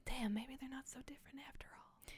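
whispered awe; hushed realisation. Retold or imitated. Audience moved by the fathers' duet, whispered realisation. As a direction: drop right down to a whisper.

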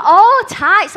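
high, loud realisation; suddenly getting it, loud and high; shopkeeper realising. Retold or imitated. Shopkeeper finally understands.

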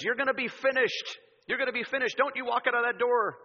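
high and forceful, acted outrage; angry boss. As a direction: play an irate boss laying down an ultimatum. Boss threatens Buddy in retold scene.

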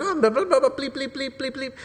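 high, rattled-off censored swearing; mock potty mouth. Peter denying he follows Jesus, mock cursing. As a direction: fake a cursing fit with high bleeps. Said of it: "he goes all potty mouth".

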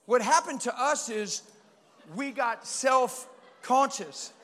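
slow emphatic point. Acted.

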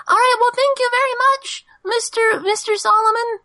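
high, tiny squeaky creature voice. Acted. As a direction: squeak politely like a tiny critter.

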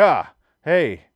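loud startled greeting; startled yelp. Retold or imitated. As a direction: jump as if he popped up beside you. Retold startle at sudden appearance.

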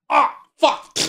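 loud strained cough and groan; hammed-up climax cough. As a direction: fake a big messy climax. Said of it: "How's it go, Kyle?".

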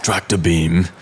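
put-on a light German accent on Tractor Beam. Designer reviving his old studio German accent. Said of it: "the German accent, that was me".

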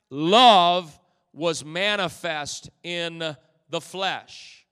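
"Love" punched loud, words slow and spaced; slow emphatic repeat. Shown as a demonstration. Preacher repeating his reworded verse, slow and loud. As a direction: land each word slowly. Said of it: "allow me to say it like this".

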